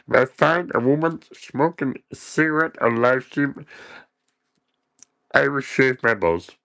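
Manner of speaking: a lightly voiced mock reader. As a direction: read it in a silly voice. Mocking viewer comment voice.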